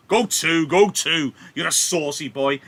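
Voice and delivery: high, loud furious scolding; angry Capulet. Acted. Capulet scolding hot-headed Tybalt at his party, shouting angrily. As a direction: bellow him down, outraged host.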